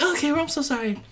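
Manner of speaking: high put-on sorry voice; mock apologetic. Mimics moviegoers' apology.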